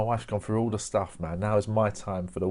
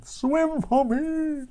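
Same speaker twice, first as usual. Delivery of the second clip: very high, very slow falsetto croon; hammed-up leering falsetto; creepy Victorian pervert; 5 dB louder, much slower than usual. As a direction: coax it out like a lurker in the bushes. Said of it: "Victorian pervert hiding in a bush going".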